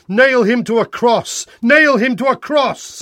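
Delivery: high-pitched yelled chant; insistent shout, rising in pitch on the repeat; mob yell. Acted. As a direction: yell it out as a demanding crowd. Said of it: "they yelled".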